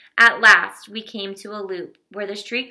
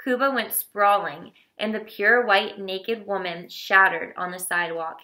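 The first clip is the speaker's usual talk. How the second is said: ordinary delivery, nothing marked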